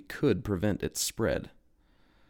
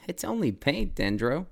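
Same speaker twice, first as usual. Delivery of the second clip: Codit's voice; 6 semitones higher than usual. A narrator's reading. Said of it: "Codit replied".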